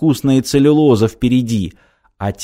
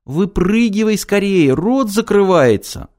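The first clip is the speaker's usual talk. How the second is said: high, urgent heroine voice; high-voiced call of warning; 8 semitones higher than usual. A narrator's reading. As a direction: urge them to get out, pitched well up. Nanochka urgently warns her beloved.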